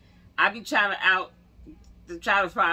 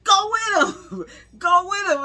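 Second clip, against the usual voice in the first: much higher and louder, urging with a laugh; crowd egging on; 11 semitones higher, 8 dB louder than usual. Retold or imitated. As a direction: cheer her on like a pushy crowd. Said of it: "People in the back going".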